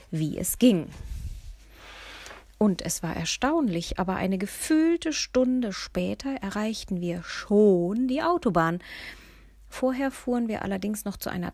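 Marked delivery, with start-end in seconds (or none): none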